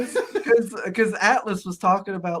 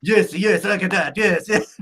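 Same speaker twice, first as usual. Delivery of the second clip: Iron Sheik impression; 7 dB louder than usual. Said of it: "he hears Sheik be like".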